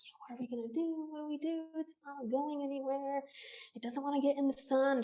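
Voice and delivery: soft, worried huddle, retold; hushed conferring. Hikers deciding what to do beside the snake, retold hushed conferring.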